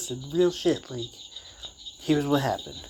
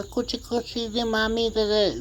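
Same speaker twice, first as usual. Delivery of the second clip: put-on higher cooing baby talk like a grandmother; imitating his grandmother cooing to him as a child, higher; grandma baby talk; 9 semitones higher than usual. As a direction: coo it like a grandma to a toddler. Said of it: "she'd have a".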